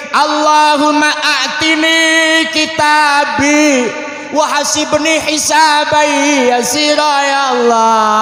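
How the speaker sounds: wailing, stretched-out prayer; tearful praying child. Acted. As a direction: plead to God like a child crying through a prayer. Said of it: "anak kecil ini wudu nangis".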